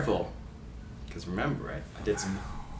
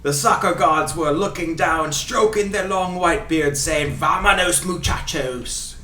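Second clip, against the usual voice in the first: put-on Ray Hudson Scottish accent. Host reading commentary quotes for guessing, in fake Scottish accent. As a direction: read it as a grand Scottish football commentator. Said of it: "doing his accent".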